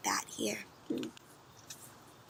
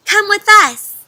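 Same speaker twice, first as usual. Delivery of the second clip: louder, higher call to come along; Mother Bat inviting; 8 semitones higher, 12 dB louder than usual. Acted. Mother bat calls Stellaluna to come along. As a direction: urge the little bat to follow. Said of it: "said Mother Bat".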